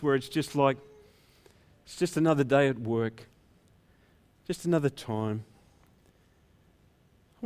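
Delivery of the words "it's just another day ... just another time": low, softened resignation; weary routine. Acted. Worker stuck in the same routine, low and weary. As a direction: voice it like a tired worker.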